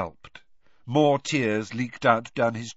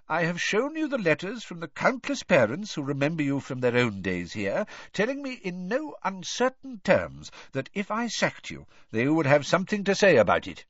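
put-on higher-pitched, reasoning old headmaster; Dumbledore, firm; 8 semitones higher than usual. A narrator's reading. Headmaster arguing a friend out of resigning, firm. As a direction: press the case as a patient headmaster.

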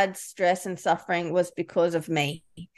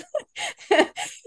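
high, amused laugh; short self-mocking burst of laughter. Real, not acted. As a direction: laugh at your own foible. Laughing at her own achiever part.

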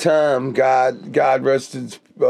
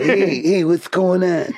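imitating Tom; 4 semitones higher than usual. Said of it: "imitating his voice so perfectly".

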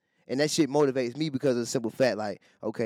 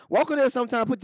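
excited hype; 10 semitones higher, faster than usual. Real, not acted. Hyping his friend's game, excited and high.

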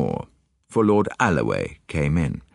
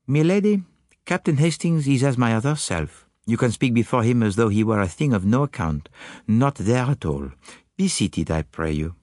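put-on higher, accented detective voice; Poirot; 6 semitones higher than usual. Detective confiding little ideas to Hastings, higher accented voice. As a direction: play the musing detective, pitched up. Poirot's dialogue in narrated story.

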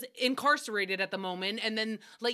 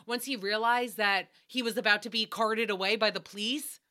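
ordinary talk, nothing marked

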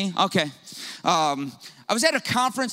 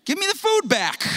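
much higher, louder comic demand; snatch the food back, jokingly; hammed-up indignant man; mock demand; 13 semitones higher, 3 dB louder than usual. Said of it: "I didn't say that".

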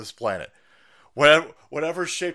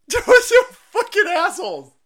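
laughing outburst; 11 dB louder than usual. Real, not acted.

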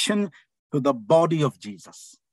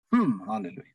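normal talking voice

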